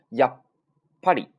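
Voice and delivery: やっ stopped at once, a silent beat, then ぱり; sokuon held stop. Shown as a demonstration. Teacher shows how to catch the sound, abrupt held stop. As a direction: choke off やっ, hold, then release ぱり. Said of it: "立刻把这个音收住".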